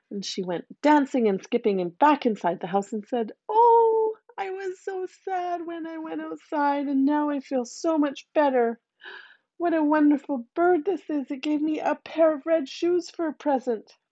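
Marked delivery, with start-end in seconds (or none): 3.5-14.0 put-on higher, happy little girl; light-hearted with her new shoes; joyful girl. A narrator's reading. Girl happy after gift.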